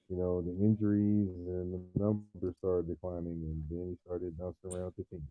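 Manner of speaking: muffled voice through a headset. Real, not acted. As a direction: sound muffled and far off. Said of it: "Oh, you sound muffled".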